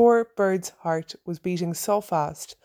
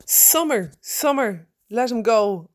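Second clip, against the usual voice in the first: screaming; 3 semitones higher, slower than usual. Acted. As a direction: scream at the dog to drop it. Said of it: "I screamed".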